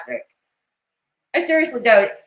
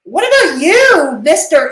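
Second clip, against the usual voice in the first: loud, higher accusing call-out; 6 semitones higher, 8 dB louder than usual. Real, not acted. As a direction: call him out loudly. Confronting guy about job.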